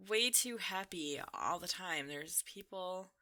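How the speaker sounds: normal talking voice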